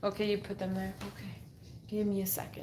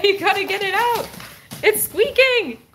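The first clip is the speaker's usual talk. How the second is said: excited urging; 8 semitones higher than usual. Real, not acted. Urging the dog.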